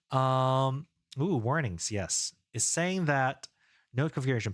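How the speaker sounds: normal talking voice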